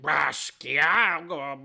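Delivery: loud guttural growl of a made-up dragon tongue. Acted. Halfling speaking Draconic to a dragon, guttural growl. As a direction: growl it out from the throat. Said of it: "gutturally growling".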